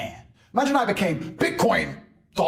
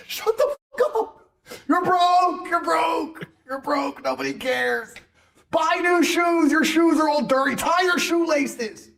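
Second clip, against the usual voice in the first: mocking yell; 10 semitones higher, slower than usual. Real, not acted. Yelling at broke crypto fans, high mocking shout. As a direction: bellow insults down at them, slow on the orders.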